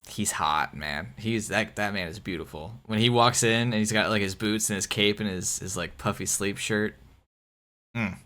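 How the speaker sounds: low, hushed, lovestruck murmur; swooning. Real, not acted. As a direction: sigh it out like you're smitten. Swooning over the King of Spain.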